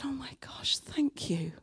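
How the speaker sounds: hushed, breathless whisper of gratitude; almost no voice, overwhelmed; whispered thanks. Retold or imitated. Retelling her reply to the friend, whispered thanks. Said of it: "I'm getting a bit teary".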